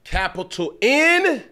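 high, loud and slow; emphatic spelling. Real, not acted. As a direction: spell it out with force.

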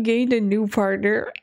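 ordinary talk

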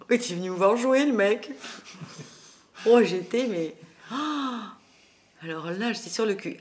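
high, loud and laughing; excited laughing. Real, not acted. Telling the interviewer Philippe came to see her play, excitedly. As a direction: burst out with delighted surprise.

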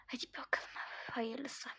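soft resigned whisper. Acted. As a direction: mutter it under your breath. Whispering to self.

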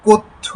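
কথ্য said alone as kotho, with the ক carrying an o sound; one crisp model word, কথ্য as kotho; pronunciation demo. Shown as a demonstration. Teacher isolating the word for students, crisp model. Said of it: "ক এর মধ্যে ওকার দিয়ে যে উচ্চারণ".

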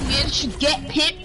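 ordinary talk

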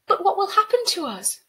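high, loud, anguished question; desperate plea. Acted. Girl asking the spirit about her people, high desperate cry. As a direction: appeal to her in alarm.

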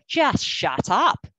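very slow, firm "shut up"; blunt shut-down. Retold or imitated. Team manager shutting down their own coach, blunt firm tone. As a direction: cut the coach off flatly.

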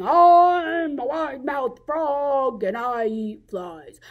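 slow, stretched frog voice, big start; wide-mouthed frog. Acted. Frog jumping through the forest boasts, drawn-out frog voice. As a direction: drawl the boast with swooping vowels. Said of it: "and jumping and saying".

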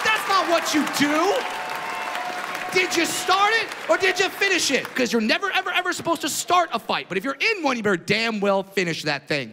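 high-pitched comic lecturing, emphatic; indignant rant. Acted. Comic correcting younger parents on fights, indignant. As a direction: hammer home the rule, worked up.

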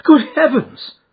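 high, loud gasped exclamation; alarmed cry. A narrator's reading. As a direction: cry out in shock. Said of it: "she cried".

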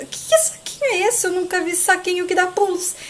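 high-pitched puzzled questioning; acted bewilderment, voice leaping high; baffled surprise. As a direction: jump up in pitch, taken aback. Olga baffled by jumping bag.

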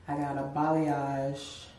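normal talking voice

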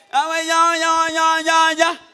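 put-on high, loud little-child babbling; toddler babble. Three-year-old answering grandfather, high babbling. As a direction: babble like a toddler who can't talk yet. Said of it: "todavía no habla bien".

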